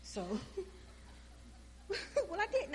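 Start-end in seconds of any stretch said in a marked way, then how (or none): 0.2-2.7 bursts into a high laugh mid-line; giggling at her own nerve. Real, not acted. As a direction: laugh through the words.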